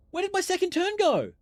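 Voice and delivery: can't believe the turn is gone; indignant disbelief. Real, not acted. Streamer demanding where the second turn went, outraged disbelief.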